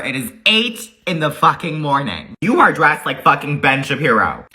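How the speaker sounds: ordinary delivery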